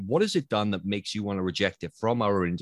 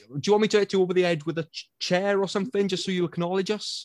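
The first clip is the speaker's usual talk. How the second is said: exasperated mock threat; 8 semitones higher, faster than usual. Exasperated actor threatening ignored studio.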